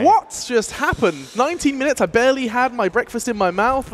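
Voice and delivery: surprised. Real, not acted. Stunned host opens panel.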